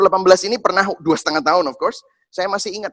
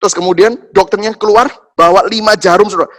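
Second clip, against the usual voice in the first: ordinary talk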